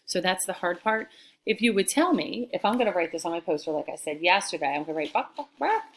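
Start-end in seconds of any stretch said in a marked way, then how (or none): 5.1-5.8 high-pitched clucks; quick put-on chicken sound; chicken clucking. Shown as a demonstration. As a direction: squawk BUCK like a hen.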